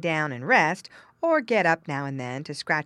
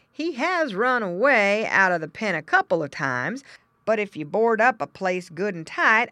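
louder, higher-pitched farmer explanation; story farmer; 4 semitones higher, 7 dB louder than usual. Acted. Farmer advising father, frank. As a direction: explain like a farmer selling his pig.

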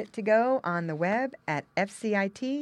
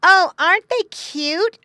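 put-on high, loud, drawn-out girlish gushing; delighted exclamation; 13 dB louder than usual. A narrator's reading. Girl looking at pigs, exclaiming. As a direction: gush over something adorable. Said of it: "exclaimed a girl".

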